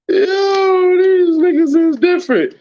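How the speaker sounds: can barely get it out for laughing; laughing through it. Real, not acted. Cracking up at the haters.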